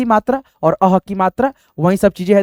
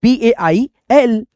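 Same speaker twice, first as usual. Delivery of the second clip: slow spelling; much slower than usual. Shown as a demonstration. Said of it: "हम लोग लिखेंगे".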